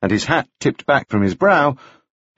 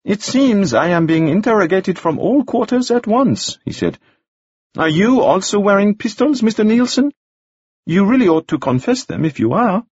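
higher, dry teasing; amused; 10 semitones higher than usual. Acted. Gascoigne teasing Nielsen, higher and amused. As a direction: needle them. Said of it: "Gascoigne looking very amused".

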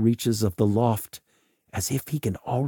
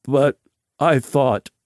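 loud, higher, halting protest; stammering; 5 semitones higher, 5 dB louder than usual. A narrator's reading. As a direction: stammer it out in broken pieces. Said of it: "stammers Veach".

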